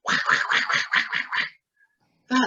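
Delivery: angry quacking squawk; Donald Duck. Acted. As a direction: imitate a cartoon duck having a fit. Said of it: "when Donald Duck gets angry".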